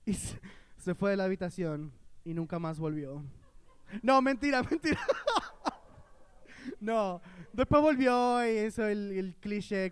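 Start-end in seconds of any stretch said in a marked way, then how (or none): none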